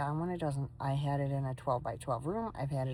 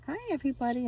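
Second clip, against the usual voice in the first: much higher voice for the dog; dog's voice; 10 semitones higher, much faster than usual. Pretend. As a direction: speak for the dog, squeaky and cute. Voicing dog's greeting.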